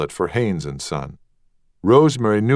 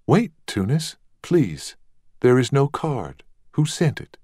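higher voiced young lady asking; pleading; 7 semitones higher than usual. Rosemary stopping Tunis from leaving, higher eager. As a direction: call him back, eager. Rosemary calls him back.

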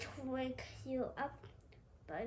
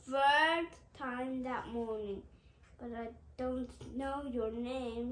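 plain reading voice

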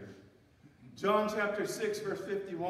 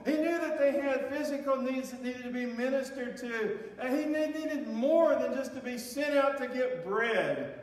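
higher, louder, driving preacher build; full-force preaching; 7 semitones higher, 6 dB louder than usual. Acted. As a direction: preach it hard, pushing the point home.

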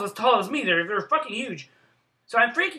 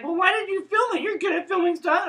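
put-on high-pitched little kid voice; seven-year-old niece; 13 semitones higher, 5 dB louder than usual. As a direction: imitate a child questioning you, pitched way up. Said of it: "my seven-year-old niece".

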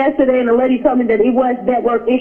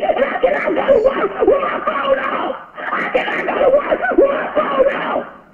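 high, crying shout; 10 semitones higher than usual. Real, not acted. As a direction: yell it, close to tears. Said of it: "please stop shouting".

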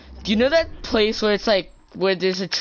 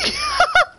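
very high gleeful laugh; 4 dB louder than usual. Real, not acted. Laughing while taunting.

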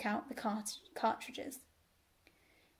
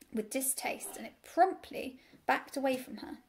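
plain reading voice

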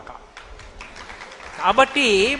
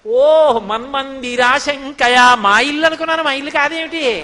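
feigned surprise; 2 semitones higher, 7 dB louder than usual. Acted. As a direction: open on a big drawn-out "oh", acting amazed. Child Krishna's feigned surprise when caught.

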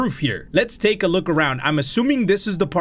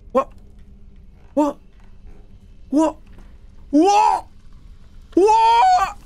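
startled; much slower than usual. Real, not acted. Startled by sound.

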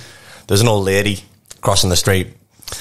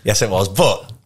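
ordinary talk, nothing marked

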